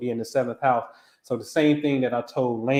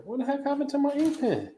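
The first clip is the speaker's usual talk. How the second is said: much higher, squeaky complaint; exasperated squeal; 13 semitones higher, 4 dB quieter than usual. Real, not acted. Host hunting for his pen in a squeaky voice. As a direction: squeal it in mock frustration.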